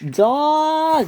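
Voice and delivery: high drawn-out cry of Dodge; high screamed name. Acted. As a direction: yell the name in alarm. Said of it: "screamed Timothy".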